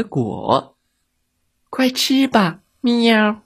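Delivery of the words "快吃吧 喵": high cat voice ending in a meow. Acted. Wildcats urge the little monster to eat, cat-like. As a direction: offer food like a kitten.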